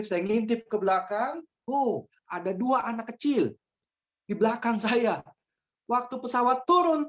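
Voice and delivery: ordinary talk, nothing marked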